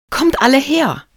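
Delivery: high, loud summons; calling out. A narrator's reading. Marie summons everyone to the spot, calling out. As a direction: call everyone over, voice lifted. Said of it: "rief sie".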